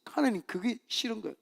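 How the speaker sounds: ordinary talk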